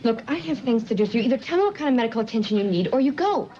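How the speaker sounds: loud, angry and impatient; fed up, raise your voice at him. Acted. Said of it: "I love it when you get angry".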